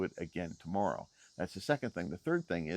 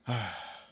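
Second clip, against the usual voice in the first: frustrated sigh. Real, not acted. Totally frustrated, trails off.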